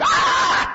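very high shouted mock scream; screaming in sleep. Devotee screaming from a nightmare, high shriek. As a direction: let out a piercing nightmare shriek. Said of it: "he would just start going".

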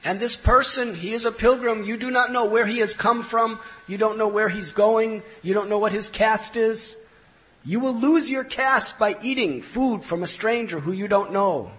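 loud, high mock warning; teasing. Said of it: "they were teasing Nimai".

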